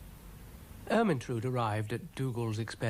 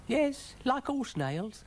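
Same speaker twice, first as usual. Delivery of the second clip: high, slow little snail voice; high-pitched cartoon snail; snail character; 14 semitones higher, slower than usual. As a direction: answer slowly in a high, small voice. Brian the snail answers.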